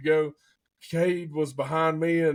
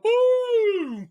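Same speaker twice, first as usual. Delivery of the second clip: loud, high mock gunshot boom; excited boom; 5 dB louder than usual. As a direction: burst out with the shot like it just happened. Gunshot in the hunting story.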